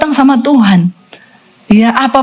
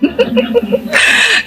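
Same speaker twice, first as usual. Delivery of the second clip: high laugh; 13 semitones higher, 3 dB quieter than usual. Real, not acted. Preacher laughs at her story.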